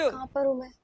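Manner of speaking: faint, low, very soft voice; dazed waking. Acted. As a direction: murmur it as if just coming round.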